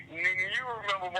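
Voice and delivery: normal talking voice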